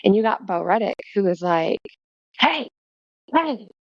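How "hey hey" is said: mock yelling. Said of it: "like, yelling at people".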